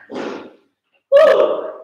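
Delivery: high breathy whoop; cheer woo. Real, not acted. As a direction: let out a loud celebratory whoop. Cheering during a hold.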